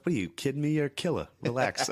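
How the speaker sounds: put-on high, excited Boston accent; imitating a fellow comic's thick Boston accent. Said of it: "He has a hilarious Boston accent".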